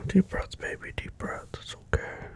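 soft, whispered breathing coach; calming whisper. Acted.

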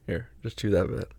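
normal talking voice

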